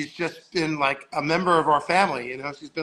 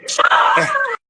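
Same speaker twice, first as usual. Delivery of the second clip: high, loud burst of laughter; big explosive laugh; 9 dB louder than usual. Real, not acted. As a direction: crack up at your own punchline. Cracks up at own punchline.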